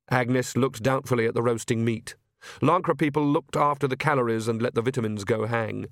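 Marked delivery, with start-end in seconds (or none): none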